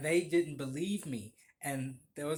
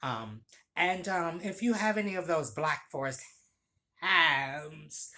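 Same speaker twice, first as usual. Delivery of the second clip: flamboyant customer; 3 semitones higher, 7 dB louder than usual. Retold or imitated. Regular walking in to order venti coffee, flamboyantly. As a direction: imitate an over-the-top effusive regular. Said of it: "he would say it like that every time".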